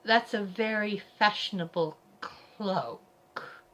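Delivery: slow, drawn-out false praise; haughty, smoking-angry politeness; seething compliment. A narrator's reading. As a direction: stretch the nice words over fury. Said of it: "Her ladyship is smoking, she's so angry".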